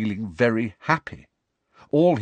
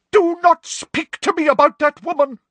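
very high, loud outburst; furious seer leaping up; cried out; 7 dB louder than usual. A narrator's reading. Trelawney to Parvati, loud and furious. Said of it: "cried Professor Trelawney".

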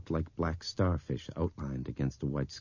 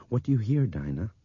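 louder, higher urgent question; 6 semitones higher, 6 dB louder than usual. A narrator's reading. Woman asking the blind girl at the window, urgently. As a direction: press for an answer. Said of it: "Laurel asked urgently".

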